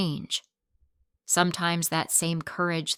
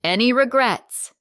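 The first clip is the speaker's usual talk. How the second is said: man's question; 5 semitones higher, 8 dB louder than usual. A narrator's reading. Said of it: "Marcus asked".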